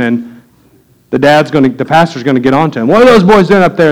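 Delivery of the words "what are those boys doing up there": a bit higher and louder, a mild scolding call; a hint of a dad catching his boys misbehaving; annoyed pastor dad. Pastor catching kids in balcony.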